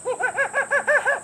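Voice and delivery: high squeaky monkey laugh; happy monkey chatter; monkey giggle. Acted. George agrees to fetch water, monkey giggle.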